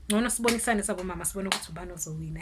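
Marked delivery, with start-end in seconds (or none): none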